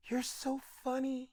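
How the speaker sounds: tiny, high, very soft imitated voice. Pop star to the comedian he just met, soft high impersonation. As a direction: say it in a hushed falsetto impersonation. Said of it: "he just looks me dead in my face and goes".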